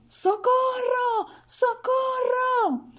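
very high, slow drawn-out cries; shouting for help. A narrator's reading. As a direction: wail for help at the top of the voice. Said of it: "empezó a gritar pidiendo socorro".

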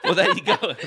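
high laughing voice. Retold or imitated. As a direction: giggle through the words. Laughing mock explanation.

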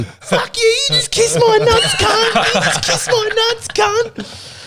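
put-on very high, slow, taunting kid voice; gloating little brother. Little brother gloats at Chris, high and slow. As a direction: taunt it like a brat who just won.

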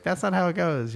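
high, a mild imitation of a child's protest; lightly voiced little girl, insistent; child's objection. Mother imitating her daughter correcting her reading. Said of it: "She's like".

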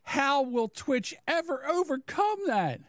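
high, slow mock-dramatic question; high-pitched mocking imitation; Eric. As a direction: draw it out high and sarcastic. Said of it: "let me get my Eric voice on".

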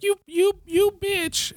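squeaky high mock English queen; mock Queen Elizabeth accent, very high; bad English accent. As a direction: screech it as a bad English posh insult. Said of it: "I don't know how to do an English accent".

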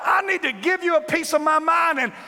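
high shouted complaint to God; telling God off. Acted. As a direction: yell it up at heaven.

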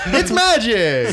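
high, loud, slow showman cry; mock magician. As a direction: announce it like a stage magician.

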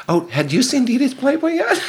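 high, mock-innocent retold question; playful matchmaker. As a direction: chirp it like a casual sales pitch. Acted pitch to cute waiter.

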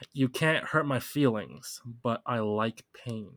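slow, deliberate lyric reading. Shown as a demonstration. Quizzing a friend on a rap lyric, read slow and deliberate. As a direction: read the quiz lyric out slowly. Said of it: "say the lyric again".

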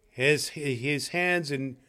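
usual speaking voice

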